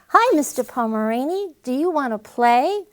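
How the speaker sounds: friendly dog voice. Acted. Jake greeting the neighbor's dog, eager and friendly. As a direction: call out hopefully to a new friend.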